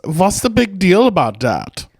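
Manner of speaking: slow, camp German accent; lilting German, drawn out. Shown as a demonstration. Coaching a friend on the accent, camp German.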